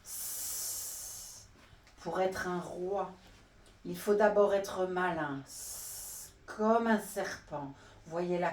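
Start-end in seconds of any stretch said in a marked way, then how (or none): 0.0-1.5 long soft unvoiced hiss; snake hiss. The snake hisses at the animals, soft unvoiced hiss. 5.5-6.3 quiet unvoiced hiss; a faint snake's hiss. Snake hisses while bragging, quiet unvoiced hiss.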